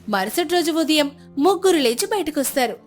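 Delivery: ordinary delivery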